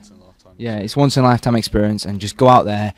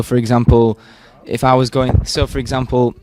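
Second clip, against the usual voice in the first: usual speaking voice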